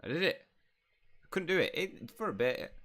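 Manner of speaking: high, loud burst of excitement; thrilled, high-pitched cry; excited outburst. Acted. Spell briefly works, player exclaims.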